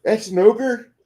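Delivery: high-pitched mock disbelief; mock incredulous player. Player at the table sees a tiny ogre figure, high mock disbelief. Mocks undersized miniature as player.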